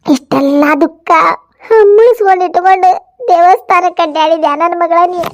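ordinary talk, nothing marked

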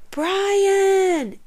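high, drawn-out call; yelling. A narrator's reading. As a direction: yell the name out long and high. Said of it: "recognized Lucy's voice yelling".

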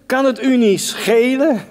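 put-upon and tense, rising into the final question; stressed complaint. Acted. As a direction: act out an indignant, stressed-out appeal. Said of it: "proef je hier de spanning, de stress?".

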